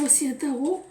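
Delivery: normal talking voice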